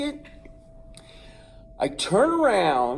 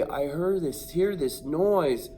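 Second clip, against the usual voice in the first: ordinary talk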